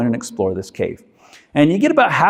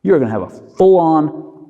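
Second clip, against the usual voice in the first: ordinary delivery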